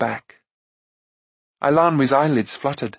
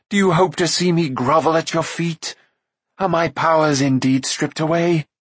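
higher, indignant queen's voice; proud cry; 4 semitones higher than usual. A narrator's reading. Fallen queen defying the man she blames, raised proud indignation. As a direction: challenge him with wounded pride and fury. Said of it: "she cried".

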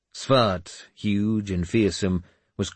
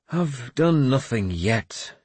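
slow, higher-pitched bitter complaint; 6 semitones higher, slower than usual. A narrator's reading. Wounded lord told he is finished, answering bitterly. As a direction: drag it out bitterly. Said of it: "I said bitterly".

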